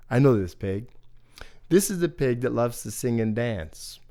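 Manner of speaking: ordinary delivery, nothing marked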